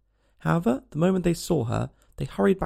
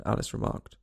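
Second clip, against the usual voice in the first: ordinary delivery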